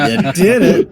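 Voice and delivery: high laughing tease; giggling you did it. Real, not acted. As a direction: crack up while teasing. Player teasing GM's slip.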